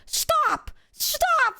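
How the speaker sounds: high, crying shout of stop; retold panicked yell. Friends screaming at the kidnapper to stop, high panicked yell. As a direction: shriek it like a scared friend. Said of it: "my friends are like".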